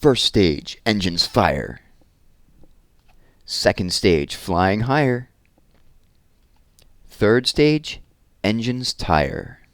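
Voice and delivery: lively, sing-song read-aloud of a rhyming book; reading to children. Acted. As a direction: read it with bounce for the little ones. Reads rhyming rocket picture book.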